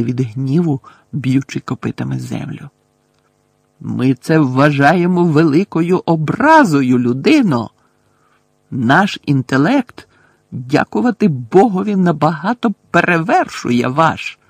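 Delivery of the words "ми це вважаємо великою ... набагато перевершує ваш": offended centaur. A narrator's reading. Magorian offended.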